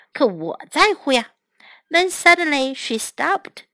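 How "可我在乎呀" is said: ordinary delivery, nothing marked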